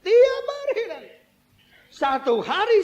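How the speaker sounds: very high, loud mock-crying wail; hammed-up howl, slowly drawn out; exaggerated wail. Preacher mimicking the widow's endless crying, wailing. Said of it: "nangis, nangis".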